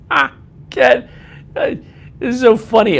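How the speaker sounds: high, loud laughing speech. Real, not acted. Streamer reacting to a viewer's message, laughing. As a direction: crack up, voice jumping way up.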